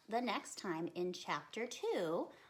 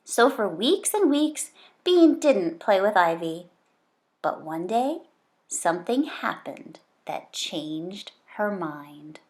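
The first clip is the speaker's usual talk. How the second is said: put-on lively, louder read-aloud for kids; story reading; 18 dB louder than usual. Reads the chapter aloud.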